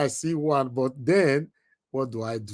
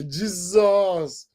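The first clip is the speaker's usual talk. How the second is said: ordinary talk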